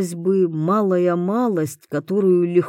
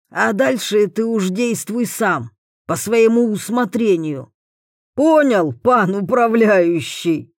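mocking overseer; 5 semitones higher, 3 dB louder than usual. A narrator's reading. Overseer mocks prisoner.

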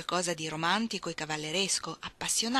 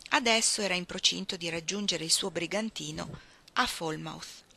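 plain reading voice

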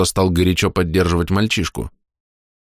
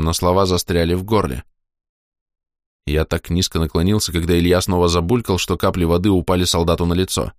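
ordinary delivery

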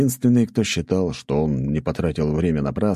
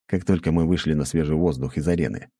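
ordinary delivery

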